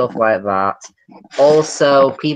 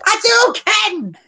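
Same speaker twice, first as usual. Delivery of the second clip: much higher, slow drawn-out battle cry; game attack shout; much slower than usual. Retold or imitated. Blue Fire blasting the computer, drawn-out game battle cry. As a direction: yell it like a fighting-game move. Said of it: "she just goes".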